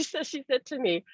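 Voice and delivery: normal talking voice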